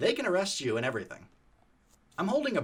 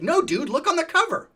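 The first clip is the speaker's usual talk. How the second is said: much higher and louder, urgent; urgent exclaim; 9 dB louder than usual. Retold or imitated. Tyler pointing at the hidden brick, frantic high voice.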